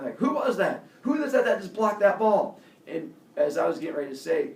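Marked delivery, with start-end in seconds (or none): 0.2-2.6 loud, higher call across the field; coach calling out. Retold or imitated. Coach calling across the field after the play, loud. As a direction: shout out asking who made the block. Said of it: "I heard the coach saying".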